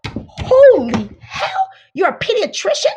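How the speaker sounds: loud, drawn-out mock squeal; hammed-up excited shout. Woman hears her date is a pediatrician, mock excited cry. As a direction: gush like you hit the jackpot.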